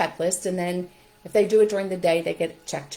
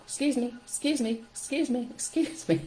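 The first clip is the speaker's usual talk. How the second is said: each "excuse me" higher and softer; quick bubbly apologies; playful rising repeats; 6 semitones higher, 4 dB quieter than usual. Shown as a demonstration. Popping bubbles rapid excuses.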